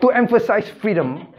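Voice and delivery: high-pitched, laughing mock justification; amused mockery. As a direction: parody the excuse through laughter. Joking parody, laugh heard.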